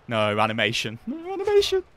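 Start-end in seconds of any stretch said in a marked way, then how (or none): 1.1-1.8 much higher, squeaky comic wail; mock outraged squeal. Real, not acted. Player wailing at the game skipping the animation, squeaky.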